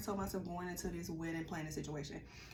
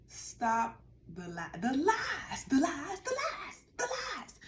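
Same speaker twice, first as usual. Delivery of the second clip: mock outraged cry; 7 semitones higher, 4 dB louder, slower than usual. Retelling outburst about lies.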